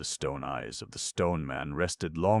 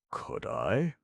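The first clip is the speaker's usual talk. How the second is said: higher, amazed gasp of a question; 6 semitones higher than usual. A narrator's reading. Crunch amazed at the clown's ideas, gasping. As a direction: breathe it out in wonder. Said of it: "gasped Crunch".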